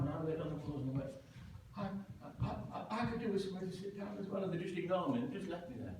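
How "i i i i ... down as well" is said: fast stammering plea. Retold or imitated.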